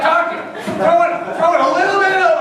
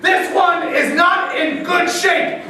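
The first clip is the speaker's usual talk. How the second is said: usual speaking voice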